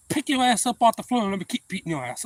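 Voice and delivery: loud, high furious threat; fight threat. Retold or imitated. Boy in a fight yelling at the bully, loud and furious. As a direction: dare him to get up.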